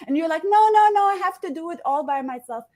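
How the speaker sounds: normal talking voice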